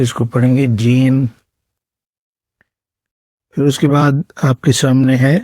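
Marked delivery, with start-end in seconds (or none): 0.8-1.2 جیم soft and gentle, a smooth j; soft Arabic jim. Shown as a demonstration. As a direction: say جیم gently, no hard edge. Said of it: "بالکل نرم طریقے سے".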